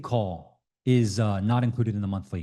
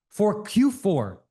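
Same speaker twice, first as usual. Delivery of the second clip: drawn-out, higher emphasis; slow emphasis; 8 semitones higher, much slower than usual. Real, not acted. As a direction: stretch "for Q four" out slowly.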